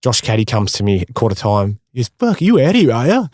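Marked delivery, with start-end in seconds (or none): 2.2-3.3 mock taunting opponent. Opponent taunting him at quarter time, mock sneer. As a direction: imitate the cocky opponent's dig.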